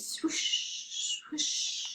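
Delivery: soft breathy wave sound; whispered swoosh. Acted. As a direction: hush it like the sea in a shell. Shell sound of sea.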